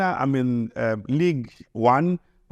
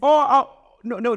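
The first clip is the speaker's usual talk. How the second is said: high, loud laughing "Oh, oh, no"; surprised laugh; 12 semitones higher, 5 dB louder than usual. Real, not acted. Guest realising the host was there, laughing in surprise.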